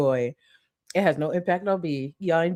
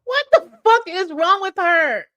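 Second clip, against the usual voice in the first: much higher, louder shriek of disbelief; shocked outburst; 14 semitones higher, 6 dB louder than usual. Real, not acted. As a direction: scream it in disbelief. Shocked reaction to the clip.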